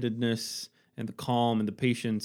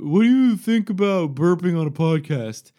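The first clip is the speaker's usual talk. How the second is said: loud, high reading out the post; mock post voice; 7 semitones higher, 10 dB louder than usual. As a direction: read it like a silly headline. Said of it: "there was this post today".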